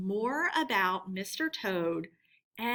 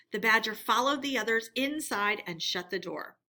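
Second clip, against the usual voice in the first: usual speaking voice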